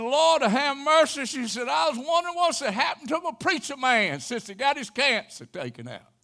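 high, loud cheerful lady's greeting; godly lady. Retold or imitated. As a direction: greet the preacher with a high, delighted call. PA greeting the preacher.

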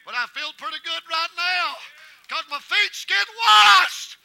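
high, very loud preacher's shout, stretching the words; full-force preaching. Real, not acted. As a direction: build to a slow, shouted exhortation.

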